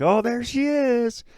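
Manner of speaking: high, loud, drawn-out cheer; mock excitement. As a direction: fake a thrilled welcome, stretching the words. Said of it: "It's not like".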